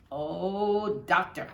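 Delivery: a touch of the delighted broadcaster closing the call; mild play-by-play "Oh, doctor" sign-off; Red's sign-off. Retold or imitated. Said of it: "And that was Red".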